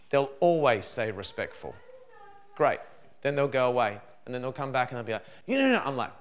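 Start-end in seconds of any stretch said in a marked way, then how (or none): 5.5-5.8 put-on childish refusal. Kids refusing, voiced by parent.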